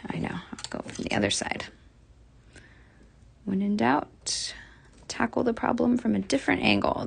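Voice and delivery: half-whispered thought to herself; muttered aside. Real, not acted. Crafter muttering a new plan to herself, hushed. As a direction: murmur the idea under your breath.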